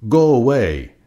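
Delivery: loud angry shout. A narrator's reading. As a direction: yell it at the intruder. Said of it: "shouted the grouchy ladybug".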